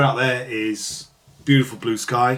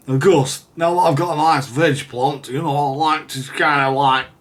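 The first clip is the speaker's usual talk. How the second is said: high, loud Lancashire-style put-on voice; Fred Dibnah impression; 4 semitones higher, 7 dB louder than usual. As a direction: do a pretty poor Fred Dibnah, louder and higher. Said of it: "That was my Fred, Fred Dibnah impression".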